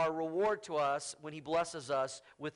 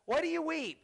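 much higher, pleading husband voice mocked; mocking clueless husband; 6 semitones higher than usual. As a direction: parody a husband fussing over his weeping wife. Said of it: "said to her".

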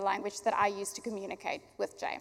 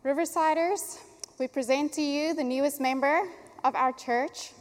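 proud announcement; 3 semitones higher, 5 dB louder than usual. Real, not acted. Presenting baby to congregation.

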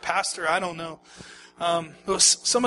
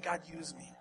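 soft prayer; 5 semitones lower, 18 dB quieter than usual. Pretend. Whispered prayer after passionate build.

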